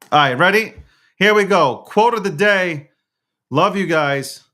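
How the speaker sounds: rally the audience for the big segment; upbeat announcement. Real, not acted. Announces the quote of the day.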